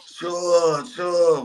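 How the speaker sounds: high, slow sarcastic taunt; Carlito impression. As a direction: taunt him slowly from up high. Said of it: "when he was like".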